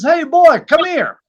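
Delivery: loud high holler; mock rancher calling out. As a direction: call the boy over loudly. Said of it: "And he says".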